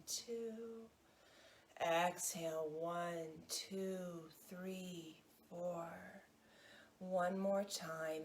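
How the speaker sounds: slow, soft counting that fades on each exhale; guided breathing meditation. Acted. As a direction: breathe the count out gently, letting it drift away.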